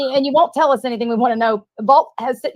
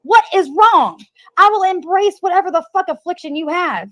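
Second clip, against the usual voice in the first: furious yelling; 7 semitones higher, 3 dB louder than usual. Real, not acted. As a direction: yell at him in disbelief. Furious at Paul in argument.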